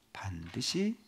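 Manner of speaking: an ominous whispered aside; hushed warning. Real, not acted. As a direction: drop to a breathy whisper. Warning of coming trouble.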